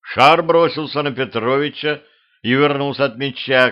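ordinary delivery, nothing marked

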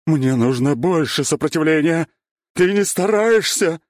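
loud, high battle roar; forceful, drawn-out competitive shout; fierce demand. A narrator's reading. Monstrous opponent demands more resistance.

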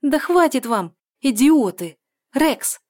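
high, loud angry yell, then calling the dog; calling loudly. A narrator's reading. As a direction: shout them down and call the dog. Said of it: "зовёт она".

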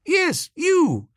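high-pitched, indignant retort; accusing. Acted. Bertie confronts an evasive Jeeves.

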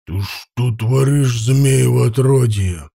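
медленно, с яростью от боли; рявкнуть сквозь боль; гневный окрик. A narrator's reading. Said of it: "взвыл от боли". (злой окрик от боли)

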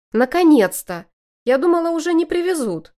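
loud, high delighted cry; excited relief. A narrator's reading. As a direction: cry out happily, it's finally here. Ellie's joyful relief.

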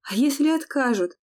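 high, loud alarmed question; frightened. A narrator's reading. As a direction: blurt it out in fright. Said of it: "испугалась Лея".

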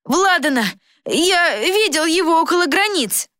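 put-on high, urgent boyish report; excited boy. A narrator's reading. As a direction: cry out the news in a boy's high voice. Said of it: "останавливая мальчика". (высокий взволнованный голос мальчишки)

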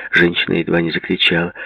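plain reading voice

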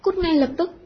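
high, loud angry order; hét vang. A narrator's reading. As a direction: shout the pupil out of the room. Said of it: "hét vang". (quát đuổi thật to, giọng cao)

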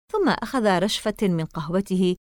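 plain reading voice

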